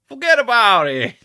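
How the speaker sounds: high, loud mobster-style Forget about it; hammed-up gangster forget about it; Chicago accent attempt. Shown as a demonstration. Trying a Chicago voice that turns out New York, high and loud.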